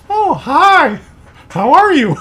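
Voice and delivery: a sudden cheerful greeting; mock cheery old mother.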